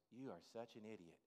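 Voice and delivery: the mocking voice in your head, hushed and low; inner critic. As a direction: mutter the put-down to yourself. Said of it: "you start hearing those voices".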